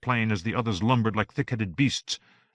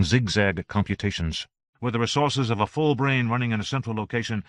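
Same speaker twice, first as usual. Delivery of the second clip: usual speaking voice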